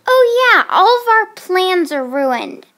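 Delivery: loud, high sarcastic complaint; exasperated outburst. Acted. Child telling a friend plans collapsed, loudly exasperated. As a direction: burst out with it, fed up. Breaking bad news to friend.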